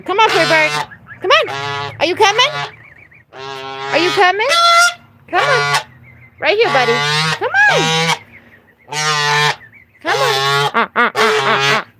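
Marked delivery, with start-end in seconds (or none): 0.0-10.3 sing-song coaxing to a bird; high coaxing pet voice. Real, not acted. Owner coaxing her parrot to come see babies, high sing-song. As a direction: coo high and bright to coax the bird out.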